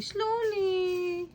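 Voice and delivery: slowly, slowly drawn out and gentle; slowly demonstrated. Shown as a demonstration. Mother showing her toddler how to turn pages slowly. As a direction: stretch out slowly as a calming example.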